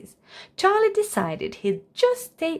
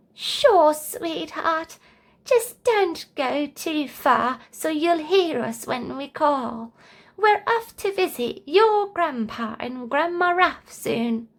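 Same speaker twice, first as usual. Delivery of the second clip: put-on high, sweet old grandmother voice; high slow granny voice; sweet grandma; 7 semitones higher, slower than usual. A narrator's reading. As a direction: coo like a doting grandma.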